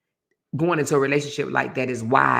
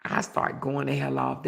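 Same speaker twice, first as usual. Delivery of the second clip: normal talking voice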